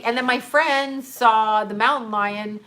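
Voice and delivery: ordinary delivery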